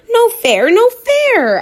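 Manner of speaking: dog who lost the ball; protesting bark. A narrator's reading. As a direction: yip in outrage. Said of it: "I bark".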